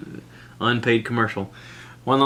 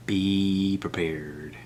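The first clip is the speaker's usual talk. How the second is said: very slow, softer, dark-toned menace; ominous warning; 3 semitones lower, 4 dB quieter, much slower than usual. Shown as a demonstration. Warning Netflix viewers, slow ominous trailer voice. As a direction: drag it out like a horror trailer.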